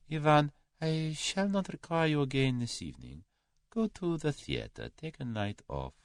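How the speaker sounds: high, soft Russian master; suave Russian employer. A narrator's reading. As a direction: dismiss your secretary with quiet courtesy.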